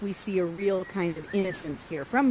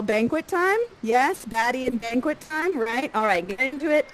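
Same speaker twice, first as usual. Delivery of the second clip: ordinary talk, nothing marked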